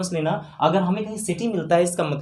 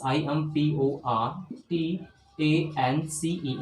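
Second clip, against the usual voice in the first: slow letter-by-letter spelling; 3 dB quieter, much slower than usual. Shown as a demonstration. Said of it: "teacher spells out letters".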